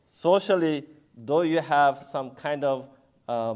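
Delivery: ordinary delivery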